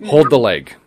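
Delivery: loud, firm order; a gruff command to hold still; old hunter teaching the kid to butcher, commanding; firm instruction. Retold or imitated. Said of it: "And he goes".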